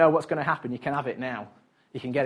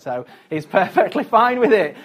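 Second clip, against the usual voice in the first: laughing; 5 semitones higher, 8 dB louder than usual. Real, not acted. Mock reply laughing at itself.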